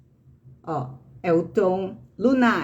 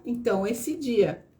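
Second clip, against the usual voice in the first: usual speaking voice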